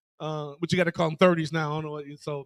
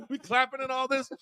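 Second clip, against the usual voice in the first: ordinary delivery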